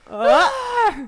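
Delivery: loud rising sneeze gasp; sneeze buildup. Retold or imitated.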